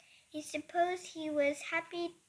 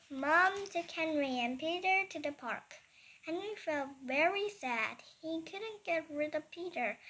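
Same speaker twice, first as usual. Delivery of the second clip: usual speaking voice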